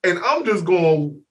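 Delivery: ordinary delivery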